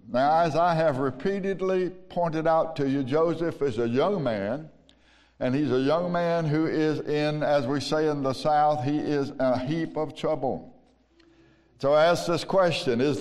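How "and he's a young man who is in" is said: usual speaking voice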